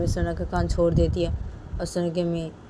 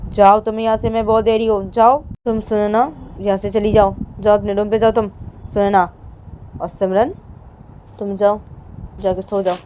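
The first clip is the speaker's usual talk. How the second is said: loud commanding dismissal; angry mother ordering; 4 semitones higher, 8 dB louder than usual. Acted. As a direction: order her out of the room.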